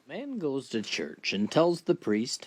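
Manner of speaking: ordinary talk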